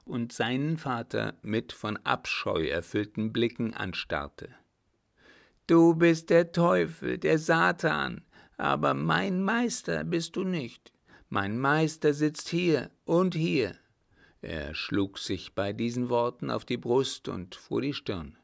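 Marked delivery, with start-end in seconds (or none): 5.7-13.8 high, loud accusation easing off; raving madman. Acted. Mad son calling his father the devil, raving. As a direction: accuse in a raised, fevered voice.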